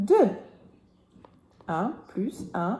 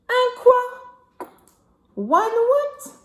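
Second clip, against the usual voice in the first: little mouse voice; 12 semitones higher, 5 dB louder, slower than usual. Acted. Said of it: "the little mouse or the little squirrel says".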